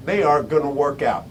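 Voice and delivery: low, soft, settled ending; dropped verdict. Real, not acted. Speaker confirming the consequence to listeners, low and final. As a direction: let it fall far down, quietly final.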